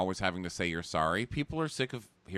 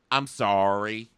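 mock apology; 4 semitones higher, 5 dB louder, much slower than usual. Quoted apology, slow emphatic.

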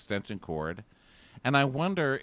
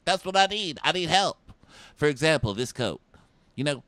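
higher, pleading imitation of Eric; mock needy Eric; 7 semitones higher, 4 dB louder than usual. Host mocks a Bachelorette contestant, higher and pleading. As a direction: beg for help like a lost puppy. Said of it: "And he's like".

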